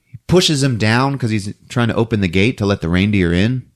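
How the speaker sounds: usual speaking voice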